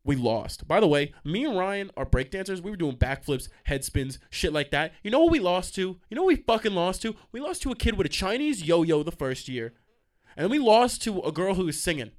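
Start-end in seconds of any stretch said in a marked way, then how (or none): 5.0-12.1 raised, higher-pitched indignant rant; getting heated. Real, not acted. Radio host recalling losing the talent show, getting heated. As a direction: work yourself up in outrage. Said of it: "I'm getting heated".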